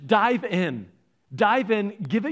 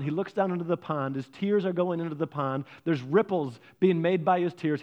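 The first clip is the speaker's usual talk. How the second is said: normal talking voice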